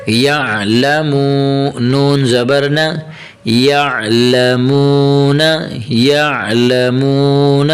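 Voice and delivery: slow, drawn-out syllable chant; teacher reciting slowly for learners to follow; slow Quranic spelling recitation. Shown as a demonstration. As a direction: stretch each long vowel evenly as you spell it out.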